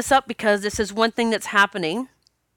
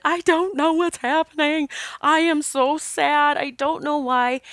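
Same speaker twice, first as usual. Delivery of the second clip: retold sad whimper; 7 semitones higher than usual. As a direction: replay your own helpless sadness. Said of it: "And I said".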